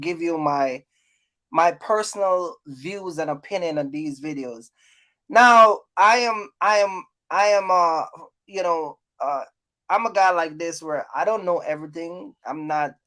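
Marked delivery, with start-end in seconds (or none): none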